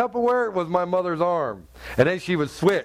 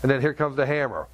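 ordinary talk

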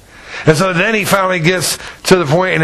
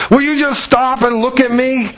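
imitating her exasperation, pitched high; mock frustrated plea for attention; frustrated demand; 7 semitones higher than usual. Woman who wanted his attention, retold with high-pitched frustration. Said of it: "you could see her frustration level".

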